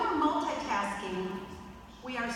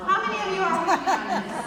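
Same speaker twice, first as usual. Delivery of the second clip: laughing appeal; 9 semitones higher, 7 dB louder, much faster than usual. Real, not acted.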